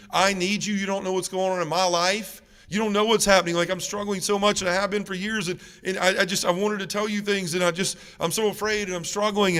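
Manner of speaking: high, urgent run-on plea; desperate pleading. Retold or imitated. Son confessing to his dying mother, retold desperate pleading. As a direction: act out a son begging his mother not to go.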